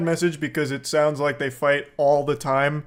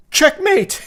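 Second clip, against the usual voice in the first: mock triumph; 11 semitones higher, 5 dB louder than usual.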